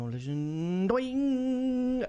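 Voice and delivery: vocal whoosh climbing to max; meter winding up. Pretend. Rating the song on the Millsy meter, rising whoosh. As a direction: pitch sweeping far up like a dial. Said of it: "just winding up the Millsy meter".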